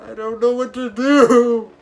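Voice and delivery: high, loud, panicked wail. Retold or imitated. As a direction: cry it out like you've been left behind. Panic as trams leave.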